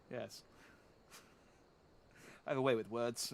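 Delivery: ordinary delivery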